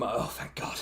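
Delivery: soft, low mutter of relief; quietly relieved aside; relieved murmur. Acted. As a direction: let it out under your breath. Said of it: "you just hear him".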